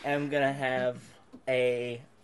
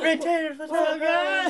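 excited shout; 12 semitones higher than usual. Pretend. Hyping up the fans.

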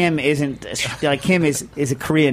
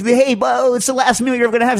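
mock excited; 8 semitones higher, 4 dB louder than usual. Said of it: "you're like".

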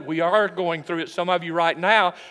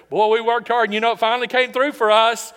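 higher, louder mock celebration; hammed-up triumphant boasting; 4 semitones higher, 5 dB louder than usual. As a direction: crow proudly as a self-congratulating crowd. Acts out boastful people after success.

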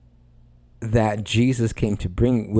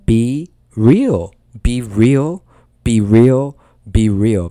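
BE REAL punched loud, word by word; emphatic exhortation; 7 dB louder, much slower than usual. Real, not acted. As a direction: hammer "Be real" louder on every repeat. Preacher drives home repeated exhortation.

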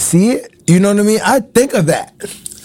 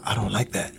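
softer, low "I don't like that"; mom's quiet warning; 9 semitones lower, 12 dB quieter than usual. Retold or imitated. Imitating his mom's quiet firm warning, softer and low. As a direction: say "I don't like that" low and soft like a stern mom. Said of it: "that's how my mom used to".

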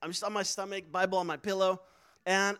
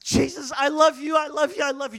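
loud, higher burst of devotion; out-loud outburst; 5 semitones higher, 7 dB louder than usual. Retold or imitated. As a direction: blurt it out repeatedly, loud and lifted. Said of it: "just out loud".

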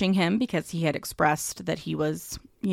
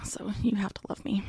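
whisper; 2 semitones higher, 7 dB quieter, faster than usual. Pretend. Whispered joke.

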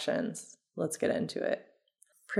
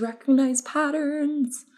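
higher-pitched, overdone intensity; really intense; 8 semitones higher than usual. Real, not acted. Presenter announcing the first step to listeners, accidentally intense. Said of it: "that was really intense".

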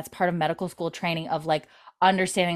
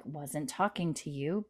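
muttered aside; 2 semitones lower, 10 dB quieter, slower than usual. Retold or imitated. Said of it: "makes that face of".

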